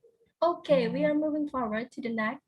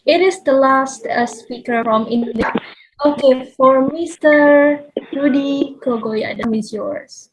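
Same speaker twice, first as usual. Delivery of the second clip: much louder, projecting to be heard; loud repeat; 2 semitones higher, 18 dB louder than usual. Real, not acted. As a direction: raise the voice so the far end hears. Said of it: "Your voice is very low".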